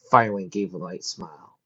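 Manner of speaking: usual speaking voice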